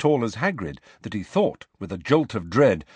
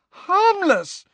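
much higher and louder, piercing; shrill; 6 dB louder than usual. A narrator's reading. Hermione aghast at Hagrid's claim, shrill outburst. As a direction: shriek the word back at him. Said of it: "said Hermione shrilly".

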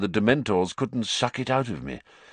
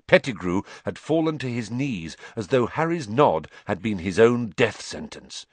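ordinary delivery

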